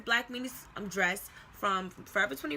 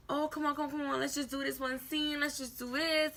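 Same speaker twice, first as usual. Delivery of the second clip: higher, quick-fire retold coaxing; 5 semitones higher than usual. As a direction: imitate someone pleading for one more take. Guy coaxing her into one scene.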